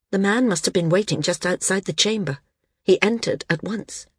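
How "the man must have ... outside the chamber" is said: ordinary delivery, nothing marked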